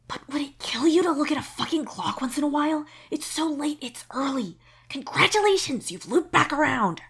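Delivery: high, loud, exasperated scolding; exasperated hushed rant. A narrator's reading. Karkat scolding Solix for staying up, exasperated. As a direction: scold him in a fierce half-whisper. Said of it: "without getting too loud".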